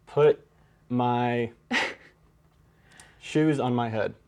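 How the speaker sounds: usual speaking voice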